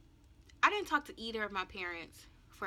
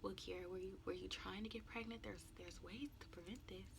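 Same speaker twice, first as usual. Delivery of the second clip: hushed, near-whispered question; calm mother; 11 dB quieter than usual. Retold or imitated. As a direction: ask it very softly, without any scolding. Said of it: "she said it just like that".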